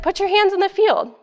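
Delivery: high, loud command; commanding surgeon. Retold or imitated. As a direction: bark the instruction across the table. Surgeon instructs student.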